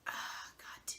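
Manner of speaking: slow whispered swearing under the breath; mock inner curse, whispered slowly. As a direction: mutter it to yourself through the pain. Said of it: "I was just like".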